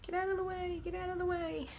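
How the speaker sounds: soft, very high little voice; playful cooing. Pretend. Crafter shooing a stray chalk bit, playful high coo. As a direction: coax it in a tiny high-pitched voice.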